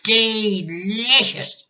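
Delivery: put-on very high, slow, pursed-lip old hag; grandma savouring the word through pursed lips; pursed-lip relish. A narrator's reading. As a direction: squeeze it through a tiny mouth. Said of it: "lips together tight ... tiny wrinkled hole".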